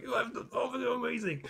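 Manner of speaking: high, very soft fanboy squeal; retold gushing. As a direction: imitate pleading enthusiasm in a small high voice. Retelling repeated gushing to friend.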